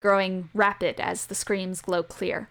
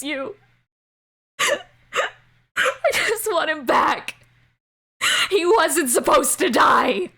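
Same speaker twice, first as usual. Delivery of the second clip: higher, breaking, crying voice building to a shout; grief-stricken sobbing; 5 semitones higher, 3 dB louder than usual. Acted. A father weeping over his dead son, crying then shouting. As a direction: sob it out, begging for him back.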